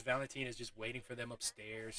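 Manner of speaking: ordinary talk, nothing marked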